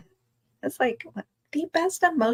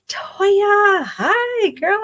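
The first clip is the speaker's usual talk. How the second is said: high, loud delighted squeal of a name; excited greeting; 13 semitones higher, 5 dB louder, much slower than usual. Real, not acted. Host greeting a long-missed friend, high delighted squeal. Spots a friend joining live.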